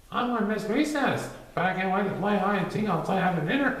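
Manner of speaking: put-on an eager kid looking ahead to summer; child voice.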